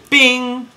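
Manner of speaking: a sharp, high-pitched cartoon bing; ping it out like a bell being struck; a bright little ding as the flower lands; high bing sound effect. Mimics flower hitting the letter.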